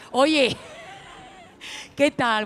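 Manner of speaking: loud, sudden hail to the crowd; calling out. Real, not acted. Comic hails the audience from the stage, loud call. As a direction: call out loudly across the room.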